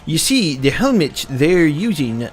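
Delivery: nerd voice. Pretend. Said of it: "I hate your nerd voice so much".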